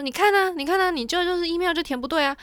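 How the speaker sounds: mock the clerk telling her off; scolding clerk. Rude clerk scolding retold.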